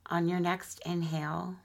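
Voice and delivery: unhurried, soothing guide through breath and body; guided meditation. Acted. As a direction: ease them gently into relaxation, phrase by phrase.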